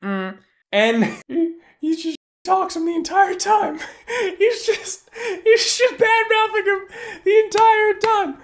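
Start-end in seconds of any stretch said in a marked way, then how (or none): 1.3-8.4 very high, cracking up while talking; squeaky with real laughter; laughing. Real, not acted. Telling how Kaz talks the entire time, helpless with laughter.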